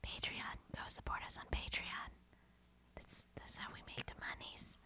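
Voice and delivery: very soft, whispered sponsor plug; whispered nudge to the listeners; hushed plug. Pretend. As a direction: whisper it as a sly aside.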